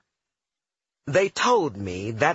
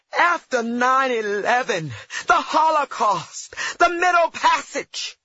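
rising, louder, higher outraged yell; shouting; 12 semitones higher, 4 dB louder than usual. Retold or imitated. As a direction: build the shout with each item. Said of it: "I shouted".

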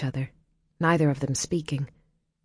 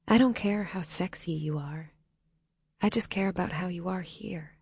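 sincere; 3 semitones higher, 5 dB quieter than usual. A narrator's reading. Earnest comfort, softly spoken.

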